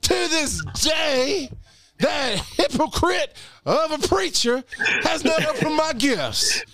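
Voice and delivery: high, booming mock preacher. Podcast host mock-preaching to his co-host in a preacher voice. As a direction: preach it like a hammed-up pastor from the pulpit. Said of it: "This is my preacher voice".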